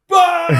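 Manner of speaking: loud, high "Bah!" guffaw; mock burst of laughter. Stalin erupting at the jibe about Churchill, mock guffaw. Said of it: "And he just laughs".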